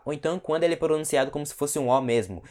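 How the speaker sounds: usual speaking voice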